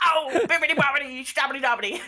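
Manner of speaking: loud, high, laughing magic chant; silly incantation. Acted. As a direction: belt out a goofy spell. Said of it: "he slaps his forehead and goes".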